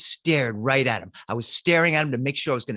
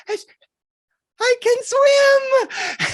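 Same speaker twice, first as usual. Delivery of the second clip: put-on very high, slow small-boy gasp; little boy voice; 7 dB louder, much slower than usual. Little boy spitting water to cousins, high small voice. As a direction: sputter it like a tiny soaked kid.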